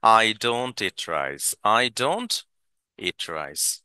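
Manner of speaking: each word spelled out very slowly; teacher drilling it word by word; slow dictation pace; slow model sentence. Shown as a demonstration. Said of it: "Just don't যুক্ত করব".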